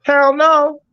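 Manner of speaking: high, loud refusal; emphatic hell no. Real, not acted. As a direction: shoot it down hard. Emphatic rejection.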